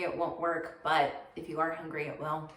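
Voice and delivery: ordinary delivery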